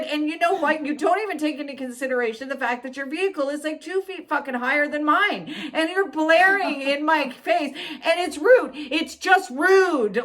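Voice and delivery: high-pitched tirade without stumbling; fed-up rant. Retold or imitated. Driver scolding the cop for glaring headlights, fed up. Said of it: "if you said it the way you just said it".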